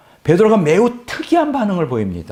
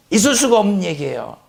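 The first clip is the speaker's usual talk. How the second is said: acted incredulous objection, pitched high; imitated heated objection, starting high; vehement protest; 4 semitones higher than usual. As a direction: protest as if it's unthinkable. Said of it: "막 격렬하게".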